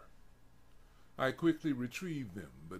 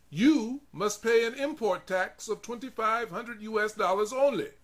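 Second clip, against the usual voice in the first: much higher, louder official pronouncement; stern officials; 11 semitones higher, 9 dB louder than usual. Acted. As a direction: lay down the law to him. Officers demanding import tax.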